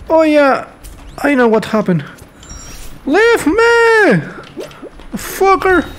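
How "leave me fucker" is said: yelling. Real, not acted. Panicked yelling at enemy in game.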